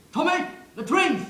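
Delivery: full-force high-pitched army yell; loud, high parade-ground shout; sergeant major's shout. Acted. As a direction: bellow it like a sergeant major calling a soldier. Quotes the Sergeant Major's favourite call.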